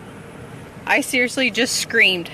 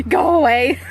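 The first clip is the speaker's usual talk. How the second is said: higher, louder laughing command; laughing shoo; 6 semitones higher, 6 dB louder than usual. Real, not acted. Telling the bug to go away while laughing.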